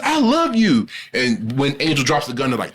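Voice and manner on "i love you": high, sweet mock plea; pleading sister. Captive sister pleads love, high mock sweetness. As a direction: coo it like a desperate sibling.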